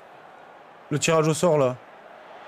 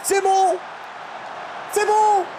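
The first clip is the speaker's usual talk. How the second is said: excited relief; 12 semitones higher, much slower than usual. Real, not acted. Relief after goal.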